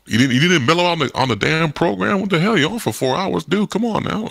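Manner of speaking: high, incredulous protest; acted exasperation at a colleague; exasperated.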